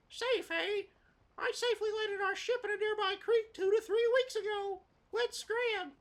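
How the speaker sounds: very high, loud squawky character voice; brash cartoon sidekick.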